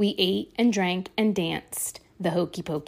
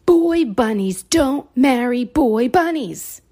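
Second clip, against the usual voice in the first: stern stink bug; 5 semitones higher, 7 dB louder than usual. Acted. Stink bug lays down rules.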